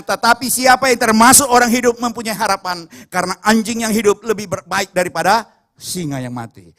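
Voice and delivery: full-force pulpit declaration; forceful proclamation. Real, not acted. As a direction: proclaim it to the whole congregation. Preacher proclaims a verse at full force.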